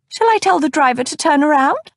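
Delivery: put-on high, fussy older lady voice; fussy older cousin. A narrator's reading. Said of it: "Cousin Batilda said".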